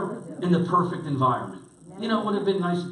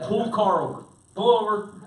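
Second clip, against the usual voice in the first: acted-out stern command, raised pitch; firm order; 5 semitones higher, 4 dB louder than usual. Fed-up passenger ordering the driver to stop, firm. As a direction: order it firmly, a little louder.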